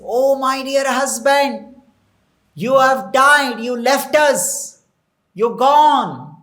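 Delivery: high, loud retold wail of grief, no tears; imitated lament. Queens mourning their dead husband, acted lament. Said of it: "They're all crying. Incessantly they're crying".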